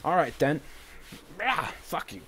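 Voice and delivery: loud, high burst of temper; retold exploding in frustration; re-enacted angry outburst. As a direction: act out losing your temper. Re-enacting outburst before throwing lamp.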